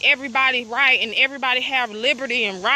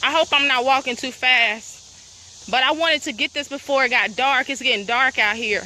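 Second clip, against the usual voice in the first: normal talking voice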